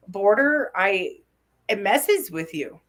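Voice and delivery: usual speaking voice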